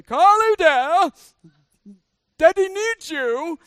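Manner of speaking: put-on loud silly accented call to the child; playful accent. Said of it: "we'd do accents".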